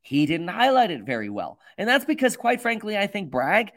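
energetic, emphatic news-commentary read; presenter delivery. Real, not acted. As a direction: open the segment briskly and punch key words. Starts recorded segment in presenter delivery.

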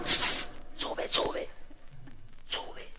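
faint whisper fading away; voiceless calling. Acted. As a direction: hiss it softly from the pulpit. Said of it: "lo llama". (susurro que se apaga)

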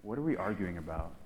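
soft, low and gentle; softly asked; quiet question. Retold or imitated. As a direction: ask it softly. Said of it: "question that Jesus asks".

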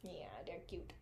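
whispered aside. Real, not acted. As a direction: breathe it out softly. Soft aside about dog.

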